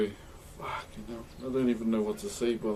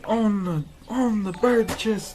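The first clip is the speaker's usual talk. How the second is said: higher, louder mock voice, drawn out slowly; imitating a friend; 8 semitones higher, 7 dB louder, slower than usual. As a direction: put on your friend's voice and stretch it out. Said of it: "As C's would say".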